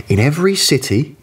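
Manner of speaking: ordinary delivery, nothing marked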